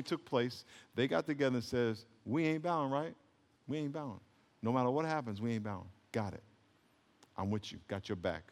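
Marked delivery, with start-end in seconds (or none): 2.2-8.4 low, very soft confiding voice; hushed pact. Acted. Three friends agreeing before the ceremony, hushed and low. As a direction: quietly agree among trusted friends.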